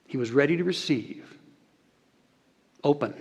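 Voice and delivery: usual speaking voice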